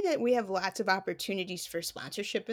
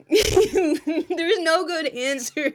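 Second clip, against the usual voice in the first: laughing through the words; 7 semitones higher, 6 dB louder, much slower than usual. Real, not acted. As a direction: laugh it out, slow. Laughing while answering.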